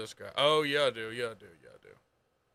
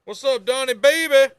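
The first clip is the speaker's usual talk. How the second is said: excited greeting; 7 semitones higher, 9 dB louder than usual. Real, not acted. Greeting the character on screen.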